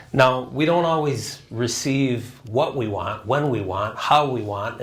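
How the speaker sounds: ordinary delivery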